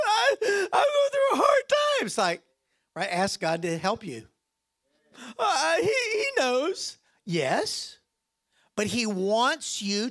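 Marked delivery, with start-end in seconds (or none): 0.1-2.1 high, mocking whine with a laugh in it; mock whiny believer. Preacher mocking a complaining believer, whining. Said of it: "You can go". 5.4-7.0 high, slow, hammed-up sob; mock crying. Preacher parodies a believer who will not ask, mock weeping.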